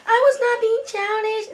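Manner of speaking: high-pitched mock protest; indignant denial. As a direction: imitate a cousin protesting indignantly. Cousin's indignant denial, retold.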